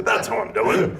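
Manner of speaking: retelling a friend's yelled punchline through laughter, mock yell. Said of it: "And he yelled it".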